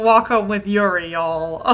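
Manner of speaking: ordinary delivery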